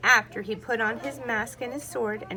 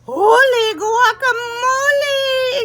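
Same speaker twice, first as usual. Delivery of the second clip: loud, high, slow amazed exclamation; exclaiming; 12 semitones higher, 13 dB louder, much slower than usual. A narrator's reading. Skippy sees himself in mirror, loud exclamation. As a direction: shout it in wonder. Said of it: "exclaimed Skippy John Jones".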